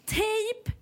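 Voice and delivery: "Tejp" pitched very high and stretched; insistent repeat. Retold or imitated. Care worker repeats herself to uncomprehending old lady, insistently high "Tejp". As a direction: push "Tejp" up high to get through.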